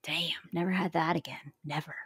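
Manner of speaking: lamenting whisper. Real, not acted. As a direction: breathe it out wistfully. Rueful aside about home life.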